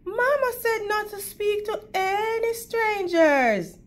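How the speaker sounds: put-on high, startled girlish voice; prim little girl. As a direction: gasp it out like a proper little girl.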